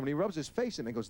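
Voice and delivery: ordinary delivery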